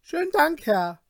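high, loud panicked refusal; frightened cry. A narrator's reading. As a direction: blurt it out in terror. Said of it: "von unerklärlicher Angst und Bangigkeit gejagt, rief er". (ängstlicher, hoher Ausruf)

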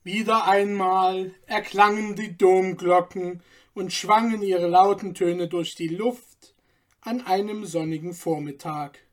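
plain reading voice

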